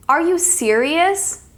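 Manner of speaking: slow, high-pitched "serious" dipping, then rising; drawn-out question with a lilt on "serious"; falling-rising intonation. Shown as a demonstration. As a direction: stretch the question and swing "serious" up at the end. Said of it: "It's falling rising".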